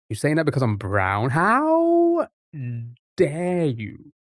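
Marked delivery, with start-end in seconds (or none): 1.3-4.1 very high, stretched "how", then drop; hammed-up squeal of outrage; mock offence. Streamer playing wounded for chat, mock offence.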